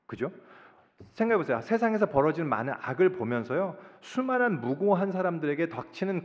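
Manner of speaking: normal talking voice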